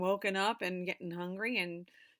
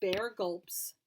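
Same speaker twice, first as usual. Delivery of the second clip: usual speaking voice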